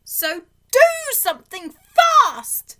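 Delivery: loud, high and slow urgent plea; urgent fish. A narrator's reading. The fish urging the children, loud and high. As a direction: push them hard to hurry. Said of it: "said the fish".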